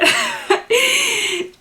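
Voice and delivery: bright high burst of laughter; laughing. Real, not acted. Laughing about the podcaster saying she often blows her nose. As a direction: crack up at the remark.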